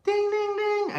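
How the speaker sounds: high ringing dings; imitated bell ding. Storyteller voices the bell for round two, high dings. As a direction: mimic a boxing bell up high.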